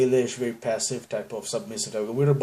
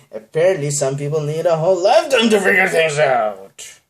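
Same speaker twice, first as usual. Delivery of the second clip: loud, higher passive-aggressive Karen; 5 semitones higher, 9 dB louder than usual. Retold or imitated. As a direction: snipe like an entitled customer. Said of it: "really a Karen".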